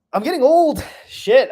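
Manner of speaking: high, loud mock panic about age; mock alarm. Real, not acted. On his last hour of twenty-seven, a high, loud mock-alarmed yelp. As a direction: yelp it out in comic dismay. Realizing he's turning twenty-eight.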